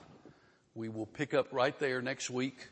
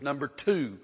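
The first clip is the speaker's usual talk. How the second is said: ordinary talk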